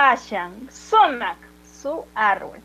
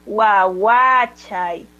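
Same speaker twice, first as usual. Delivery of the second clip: guaguachay dragged out very slowly; very slow model word; 2 semitones lower, 4 dB louder, much slower than usual. Shown as a demonstration. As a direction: stretch every syllable of guaguachay. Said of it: "¿Cómo sería?". (guaguachay, muy despacio y marcado)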